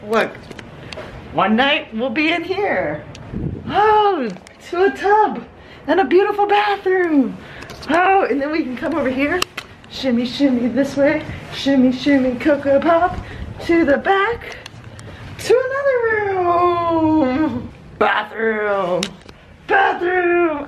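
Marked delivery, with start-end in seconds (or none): 1.3-17.7 high, thrilled room-tour voice; excited planning. Real, not acted. 19.7-20.6 high delighted cry; excited shout. Real, not acted.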